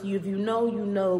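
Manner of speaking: ordinary talk, nothing marked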